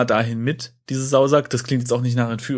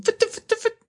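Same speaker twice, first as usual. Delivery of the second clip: skipping record. Acted. Redoes the stuck record bit.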